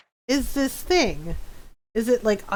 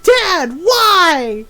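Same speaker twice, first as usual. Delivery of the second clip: put-on loud, high kid's complaint; mock wailing protest; 7 semitones higher, 8 dB louder than usual. Kid protesting to laughing dad, mock wailing protest. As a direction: wail it loudly, imitating the wronged kid.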